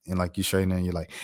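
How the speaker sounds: usual speaking voice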